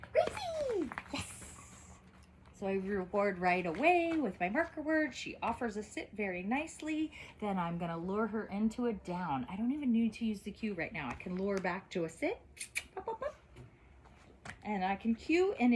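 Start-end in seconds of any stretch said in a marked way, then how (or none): 0.1-1.3 bright high praise call; high excited call. Real, not acted. Calling puppy by name. 13.0-13.4 high-pitched lure. Real, not acted. Luring puppy up.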